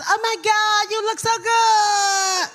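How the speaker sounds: high, drawn-out phony gush; mock a fake Hollywood type holding her breath; fake bougie gushing. Mocking Hollywood people greeting each other, high breath-held gush. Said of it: "and talk like this".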